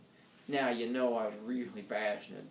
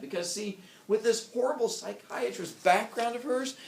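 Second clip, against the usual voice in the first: plain reading voice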